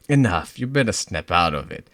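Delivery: usual speaking voice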